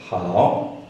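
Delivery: "Hao" dipping then rising; third tone. Shown as a demonstration. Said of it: "with the third tone".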